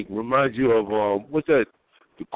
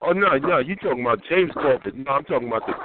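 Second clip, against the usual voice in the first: ordinary delivery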